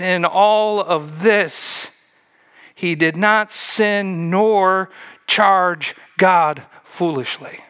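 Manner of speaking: slow, weighty scripture quote; solemn reading. Real, not acted. Preacher reading Job's verse to the congregation, slow and grave. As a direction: lay each word down slowly and solemnly.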